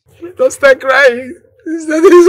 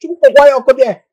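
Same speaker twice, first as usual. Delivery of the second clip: ordinary delivery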